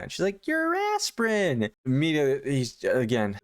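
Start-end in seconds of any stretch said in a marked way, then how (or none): 0.5-1.7 high, singled-out mock answer; imitated wife. Wife explains the crunch to husband, in put-on innocence.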